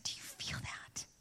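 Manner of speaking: very soft whispered question; hushed awe. Retold or imitated. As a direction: whisper it as if sensing something. Retold awed question after feeling peace.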